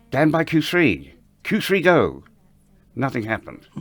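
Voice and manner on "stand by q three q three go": higher, slow, pressing repeat of the call; retold insistent cue repeat. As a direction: say it again, leaning harder on it.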